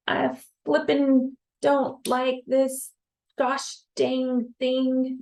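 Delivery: high, slow, prim fake-swear voice; restrained mock cursing. Shown as a demonstration. Teacher shows holding back a curse, high and slow. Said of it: "trying to restrain what we really wanna say".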